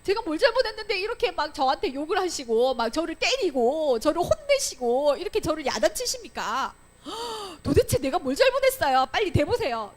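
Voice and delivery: high, loud, insistent demand for reasons; arguing back. Acted. Teacher plays a wrongly scolded child to the adult, arguing back. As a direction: act out an aggrieved child challenging an adult. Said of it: "따져보지".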